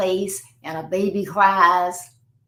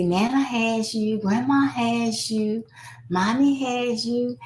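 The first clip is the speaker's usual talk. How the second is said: slow, higher-pitched comforting lilt; soothing; 4 semitones higher, slower than usual. Acted. Family member comforting a sick child, soothing. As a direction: cradle the child with drawn-out reassurance. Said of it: "that soothing voice that they recognize".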